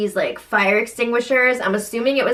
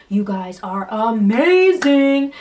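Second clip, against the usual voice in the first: slow, drawn-out gushing cheer; imitated cheerleader; 3 dB louder, slower than usual. Housemate cheering on the players from the sidelines, gushing. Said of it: "the best cheerleader on the sidelines".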